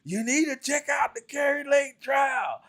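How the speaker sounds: high, shouted mock of an overexcited caller; excited caller. Mocking Sarge's excited phone call about the trial, high shouting. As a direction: ham up the breathless caller, high and yelling. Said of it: "that man was so excited when he called me".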